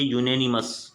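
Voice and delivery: यूनेनिमस with a "you" sound at the start, you-nanimous; correct pronunciation. Shown as a demonstration. Teacher giving students the right way, "you-nanimous". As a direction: say यूनेनिमस starting "yoo", not "an". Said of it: "इसको हम लोगों को पढ़ना चाहिए".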